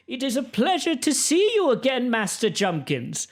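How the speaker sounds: high-pitched, gracious noblewoman; happy lady greeting. Acted. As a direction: greet an old acquaintance happily in a high lady's voice. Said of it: "Lady Olena happily greets him".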